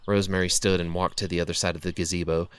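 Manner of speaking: ordinary delivery, nothing marked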